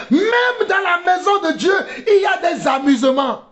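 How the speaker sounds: high, shouted rebuke; fiery preacher's shout; impassioned preaching. Real, not acted. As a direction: cry out in indignation from the pulpit. Rebuke shouted, later repeated softly.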